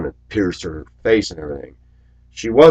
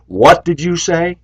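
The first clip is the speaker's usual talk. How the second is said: high, loud and slow challenge; menacing challenge; 10 semitones higher, 6 dB louder, slower than usual. Pretend. Driver pinning bully imagines challenge, loud slow. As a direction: loom over him and demand it.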